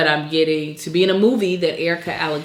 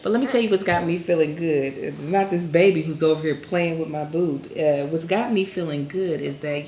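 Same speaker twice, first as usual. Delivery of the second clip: usual speaking voice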